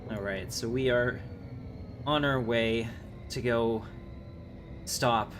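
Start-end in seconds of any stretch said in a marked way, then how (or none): none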